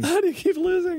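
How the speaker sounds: high, slow mock scolding; mocking girlfriend voice. Cohost mimics the girlfriend scolding him, slow and high.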